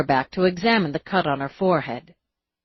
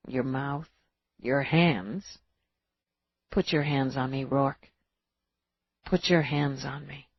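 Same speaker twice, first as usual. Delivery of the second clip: intimate; 3 semitones lower, 7 dB quieter than usual. Acted. Seductive low plea.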